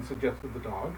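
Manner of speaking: plain reading voice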